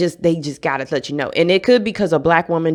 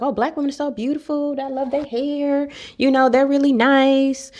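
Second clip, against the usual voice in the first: gushing stepmom; 9 semitones higher, 3 dB quieter than usual. Retold or imitated. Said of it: "She's like".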